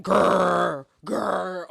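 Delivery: rough "grr" caricaturing a macho man; throaty put-on growl; mock manly growl. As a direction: growl like a cartoon tough guy. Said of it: "doesn't have to always be".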